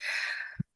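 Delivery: long, soft audible exhale; breathing out. Shown as a demonstration. Coach leading gratitude breathing, soft exhale. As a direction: let the breath out slowly and softly. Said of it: "So deep breath in and out".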